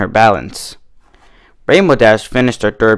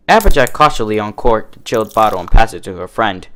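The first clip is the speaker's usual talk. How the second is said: ordinary delivery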